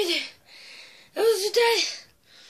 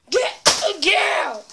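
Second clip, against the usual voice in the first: louder, higher shout; angry yell; 6 semitones higher, 7 dB louder, slower than usual. Real, not acted. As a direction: shout it away from your face. Yelling at balloon.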